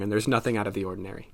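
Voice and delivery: normal talking voice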